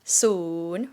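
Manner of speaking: soon dips low then rises; Thai rising tone. Shown as a demonstration. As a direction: scoop soon down and up. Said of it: "rising tone".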